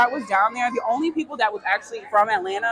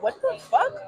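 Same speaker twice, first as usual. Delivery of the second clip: sharply higher, stunned; shocked; 12 semitones higher than usual. Retold or imitated. Retelling the slap in the car, a shocked high blurt. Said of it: "and I'm like".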